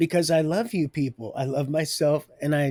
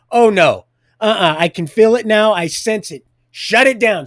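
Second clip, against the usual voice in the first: loud objection; 4 semitones higher, 8 dB louder than usual. Retold or imitated. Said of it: "she is going".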